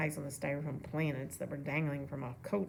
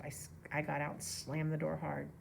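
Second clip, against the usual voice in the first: plain reading voice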